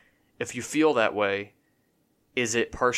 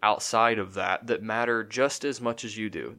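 ordinary delivery

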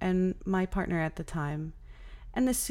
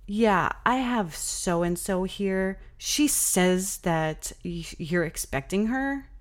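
slow, matter-of-fact phone call; flat phone report; slower than usual. Retold or imitated. Guard calling labor ward, slow and matter-of-fact. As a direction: read it out like routine paperwork. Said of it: "Like, just like that".

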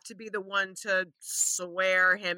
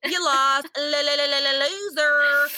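taunting singsong; 3 semitones higher, 4 dB louder than usual. Pretend. Taunting Trump.

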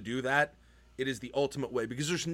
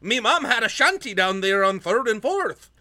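put-on Irish accent; 9 semitones higher, 9 dB louder than usual. Bragging his Irish accent is getting good, hammed-up Irish brogue. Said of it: "my Irish accent is getting good".

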